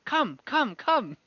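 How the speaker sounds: a lightly voiced little kobold insisting, in halting words; kobold guide.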